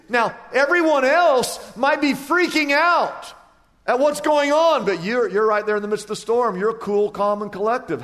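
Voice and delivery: high, loud, stretched preaching cry; preaching at full force. Acted. Preacher rousing the congregation about calm in the storm, full force.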